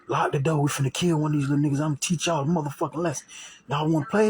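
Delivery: threatening intruder. Retold or imitated.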